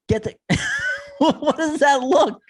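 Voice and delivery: cracking up mid-question; laughing. Real, not acted. Host asking the cohost, laughing through it.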